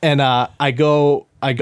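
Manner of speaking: ordinary delivery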